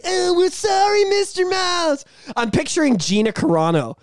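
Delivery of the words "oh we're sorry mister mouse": high, slow, whiny apology; mock whimpering apology. Jonas Brothers apologizing to Mickey Mouse, whiny. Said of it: "they're like".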